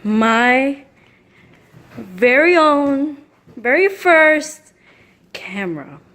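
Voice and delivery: loud, slow, thrilled announcement; drawn out with glee; excited. Real, not acted. Shows off his first camera.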